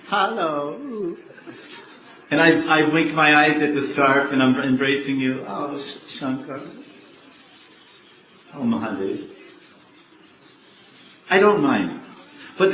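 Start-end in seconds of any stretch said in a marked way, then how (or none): 0.1-1.1 high, playful little hello from the skull necklace; cheeky skull greeting. Retold or imitated. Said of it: "and the scarf's going". 5.5-9.3 soft, hushed, loving sigh of his name; tender sigh. Retold or imitated. Parvati sighs while embracing Shiva.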